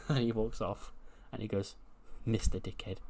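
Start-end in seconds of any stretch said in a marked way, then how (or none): 2.2-3.0 softer, under-the-breath repeat; muttered echo. Acted. Wrestler echoing his departed boss's demand, muttered. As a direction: say it low to yourself after he has gone. Repeats the title after boss leaves.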